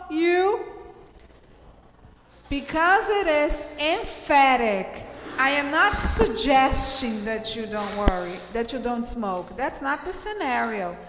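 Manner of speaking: ordinary delivery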